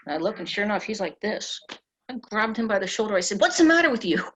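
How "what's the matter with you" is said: loud, high-pitched retold demand; alarmed shout. Passenger grabs slumped pilot by the shoulder, shouting. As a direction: re-enact yelling at someone to rouse them.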